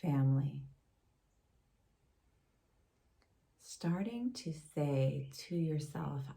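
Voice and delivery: calm, unhurried meditation voice with long pauses; guided meditation. Acted. Yoga teacher leading a gratitude meditation for listeners, guided meditation. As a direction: gently guide them inward, leaving space between phrases.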